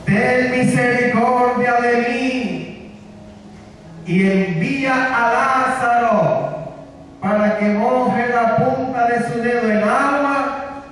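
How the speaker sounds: slow, stretched-out pleading; tormented plea. Retold or imitated. Tormented rich man begging Abraham for water, pleading. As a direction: beg across a great distance, drawing the words out. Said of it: "este rico dio voces".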